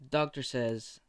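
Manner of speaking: ordinary delivery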